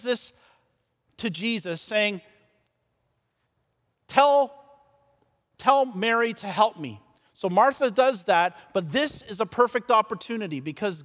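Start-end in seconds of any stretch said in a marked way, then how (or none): 4.1-7.0 bursting-in demand. Acted. Martha bursts in on Jesus to complain, demanding. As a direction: blurt it out as a mildly exasperated demand. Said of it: "Martha comes bursting into the living room".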